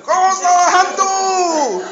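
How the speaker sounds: high squeal of ghost; mock fright. Preacher acts one disciple shrieking in fear. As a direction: cry out like a scared disciple. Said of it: "one person".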